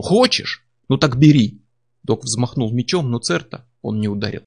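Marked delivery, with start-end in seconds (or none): 0.0-1.6 much higher, rising taunt then loud; mocking yell. A narrator's reading. Doc yelling a taunt at his comrade, high and loud. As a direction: throw the offer at him, jeering.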